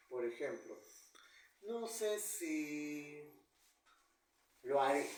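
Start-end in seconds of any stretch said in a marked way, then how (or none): 1.6-5.1 very slow no sé si, long pause, then lo haré; suspended, neutral tune. Shown as a demonstration. Teacher showing a suspension-point pause with neutral tune. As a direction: hold no sé si level, pause, then land lo haré. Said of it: "He dejado una omisión".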